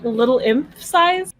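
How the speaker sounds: ordinary talk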